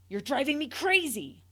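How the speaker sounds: loud, exasperated scream; mother screaming at her child. A narrator's reading. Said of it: "the matifamilias screamed".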